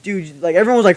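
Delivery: ordinary delivery, nothing marked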